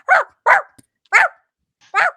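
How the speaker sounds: shrill dog reply in barks; dog barking back. Acted. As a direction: answer like an excited little dog. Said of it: "the dog just replies back".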